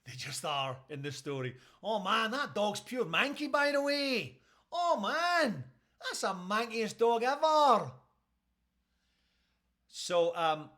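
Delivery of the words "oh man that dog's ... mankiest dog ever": loud, high hammed-up Scottish exclamation; over-the-top Scottish outburst; Scottish animal welfare officer. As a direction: cry it out like an appalled Scottish officer. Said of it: "using Scottish phrases like manky".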